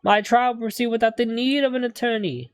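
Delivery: eccentric game defendant. Acted. Client haggling with two students over payment, pompous and high. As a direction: put on an affected London accent. Voices defendant pleading his case.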